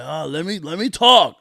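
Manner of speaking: a hint of a bossy old agent, loud on talk; mocking the TSA agent barking orders, a touch bossier. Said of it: "start barking orders like".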